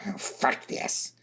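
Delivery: whispered, slow, exasperated; fed-up Tarzi. A narrator's reading. Tarzi, looking back at the car, fed up. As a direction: breathe it out, done with it. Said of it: "she said".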